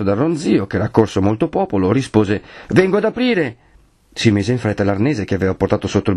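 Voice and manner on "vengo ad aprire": high-pitched answering call; calling back. Acted. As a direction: call back to those outside. Bell-ringer answers from inside. (risposta gridata, acuta)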